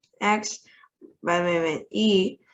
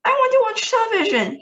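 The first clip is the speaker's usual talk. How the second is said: a much higher, fast, louder squealing demand; squeaked tantrum; 5 dB louder, faster than usual. A narrator's reading. Tiny boy throwing a tantrum in his mother's hand, high fast squeal. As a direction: scream it like a furious mouse-sized boy. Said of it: "he squeaked".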